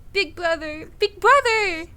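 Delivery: frantic shouts for big brother; calling out. Acted. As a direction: call out desperately. Character calls out in quake.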